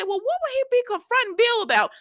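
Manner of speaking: high-pitched disbelief; incredulous question. Acted. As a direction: ask it high, totally baffled. Acted puzzled reaction to spoilers.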